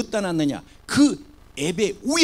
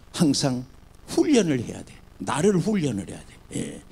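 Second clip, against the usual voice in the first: usual speaking voice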